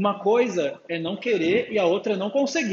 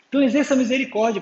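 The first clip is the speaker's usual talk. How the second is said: fast, higher and louder, like an impatient chore; mock exasperated rush; grudging, annoyed; 6 semitones higher, 5 dB louder, faster than usual. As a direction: blurt it out as if sick of hearing it. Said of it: "Pô, que saco de novo".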